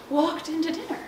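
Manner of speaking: ordinary delivery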